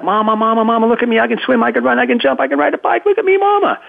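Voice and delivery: put-on high, eager little-kid voice calling for mama's attention; child calling mama. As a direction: play a small child begging to be watched. Adult voicing small child seeking approval.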